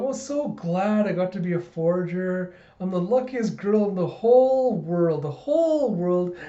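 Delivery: high, cutesy delight swelling on "whole world"; gushing little girl. Retold or imitated. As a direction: squeal with mock childish bliss. Child gushing while hugging dog.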